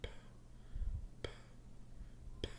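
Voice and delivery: repeated breathy "p" with no voice; teacher demonstrating "p"; unvoiced p demo. Shown as a demonstration. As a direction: pop out "p" three times, unvoiced. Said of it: "do the P sound".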